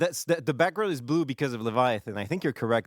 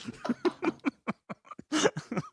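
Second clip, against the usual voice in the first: high burst of laughter. Real, not acted. Imp wrote lol joke.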